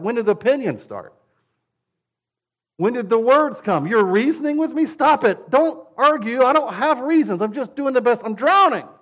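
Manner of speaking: put-on overwhelmed, losing it at a reasoning child; exasperated parent.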